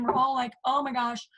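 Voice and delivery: usual speaking voice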